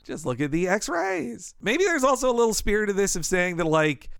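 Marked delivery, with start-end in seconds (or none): 0.1-1.6 slow imitation, x-rays swooping and stretched; Azaria's X-rays. Quack doctor showing off the x-rays, last word drawn out. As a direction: draw out x-rays with a theatrical swoop. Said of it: "the way Azari says".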